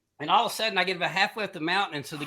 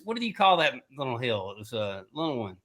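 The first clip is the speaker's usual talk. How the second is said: normal talking voice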